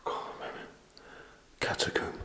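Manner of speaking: soft hushed question; whispering. Real, not acted. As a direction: ask under your breath. Whispering during ghost hunt.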